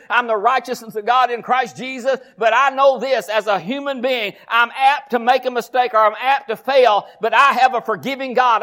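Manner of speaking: normal talking voice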